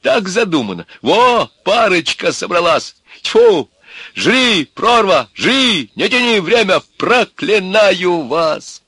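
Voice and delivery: высокий, громкий, возмущённый крик; выкрикивать проклятия, сорвавшись на высокие ноты; гневное проклятие. A narrator's reading. Иван клянёт парочку перед смертью. (яростно, с отчаянием обречённого)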